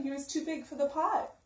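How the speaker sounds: usual speaking voice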